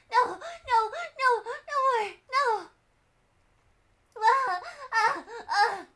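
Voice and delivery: put-on high, pleading no's of a frightened child; scared child begging; panicked girl. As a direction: beg them to stop, voice up high. Satoko refusing, panicked.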